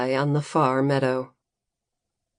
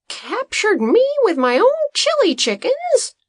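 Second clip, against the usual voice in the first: much higher, louder outraged question; outraged Green; 4 dB louder than usual. A narrator's reading. Farmer learning he was locked up by his own chickens, outraged high question. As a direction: rise in disbelief to a fever pitch. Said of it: "his outrage and voice reached a fever pitch".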